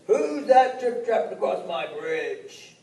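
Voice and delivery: put-on mean old troll. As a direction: snarl the question. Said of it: "that mean old troll's voice".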